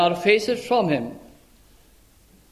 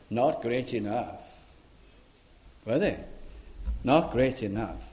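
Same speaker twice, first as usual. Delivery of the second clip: quiet aside; 7 semitones lower, 8 dB quieter than usual. Real, not acted.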